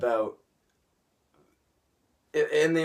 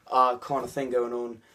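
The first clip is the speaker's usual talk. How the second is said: ordinary delivery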